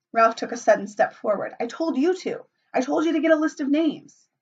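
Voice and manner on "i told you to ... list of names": sharp rebuke. A narrator's reading. Ralph rebukes Piggy.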